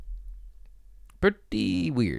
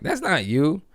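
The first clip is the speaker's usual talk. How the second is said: higher, insisting it's not him; incredulous retort; 9 semitones higher than usual. Retold or imitated. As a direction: call his bluff in a high, disbelieving voice. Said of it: "I'm like".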